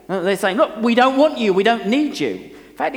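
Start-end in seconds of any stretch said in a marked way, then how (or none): 0.6-2.3 rude. Retold or imitated. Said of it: "it was bordering on rudeness".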